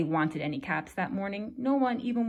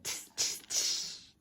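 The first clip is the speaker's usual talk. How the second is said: whispered tss tss of mocking monkeys; teasing whispered tsk-tsk; 7 dB quieter, much slower than usual. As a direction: hiss it like cheeky monkeys. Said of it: "and said".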